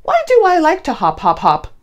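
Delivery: ordinary delivery, nothing marked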